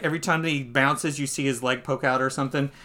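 usual speaking voice

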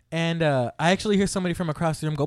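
ordinary talk, nothing marked

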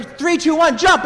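put-on high-pitched kids' countdown, rising in volume; rally the toddler for another jump; excited countdown. Siblings cheer little sister's next try, excited countdown.

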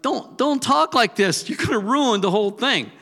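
high, loud pleading voice; eager disciple. Retold or imitated. Disciple begging Jesus not to ruin it, loud and high. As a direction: beg him to stop talking like that. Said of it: "It's like".

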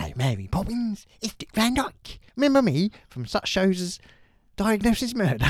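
mock Cockney showman hello. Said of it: "while I do an impression of him".

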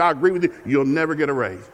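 ordinary talk

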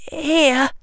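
put-on high, timid boy's voice; a frightened child giving up his wand; scared young boy. A narrator's reading. Frightened boy hands over wand.

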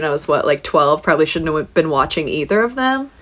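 ordinary talk

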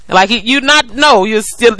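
ordinary talk

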